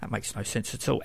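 ordinary talk

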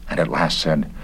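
ordinary delivery

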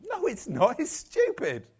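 high-pitched, indignant squawk; mock incredulous. Preacher rebutting the religious readers, mock incredulous. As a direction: protest in comic disbelief. Mock outburst against pious reading.

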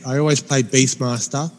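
normal talking voice

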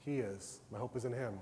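softly voiced peaceful self-talk before sleep; calm inner reassurance. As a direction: talk yourself down gently, at peace.

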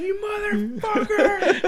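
high, slow yell through laughter; laughing yell. Retold or imitated. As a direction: scream it while cracking up. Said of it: "like, like".

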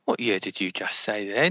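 normal talking voice

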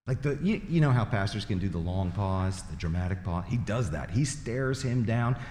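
normal talking voice

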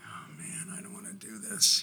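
whispered mock dread, rising high. Boy walking out into the hallway with teacher, whispered dread. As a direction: act out a small, reluctant whimper.

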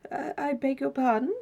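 politely puzzled, tentative question; doubtful. A narrator's reading. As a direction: ask it doubtfully, lifting the pitch. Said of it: "he said doubtfully".

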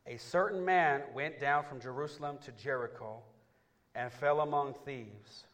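slow, loud, rising Bible read; building sermon read; dramatic scripture reading. Acted. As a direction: paint the robbery for the church.

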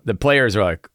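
ordinary talk, nothing marked

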